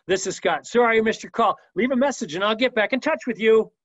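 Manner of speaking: high, loud and full of inflection; bubbly sunshine greeting, pushed up in pitch; energetic, enthusiastic voicemail. Shown as a demonstration. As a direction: gush it out with big energy. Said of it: "Energy, enthusiasm, inflection in my voice".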